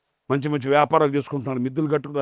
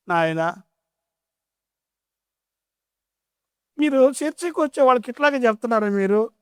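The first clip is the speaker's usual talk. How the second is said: put-on old mother complaining; 9 semitones higher than usual.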